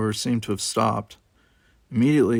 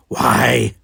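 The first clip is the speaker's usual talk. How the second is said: Kodash's loud why; 8 dB louder than usual. Acted. Kodash questions Worf.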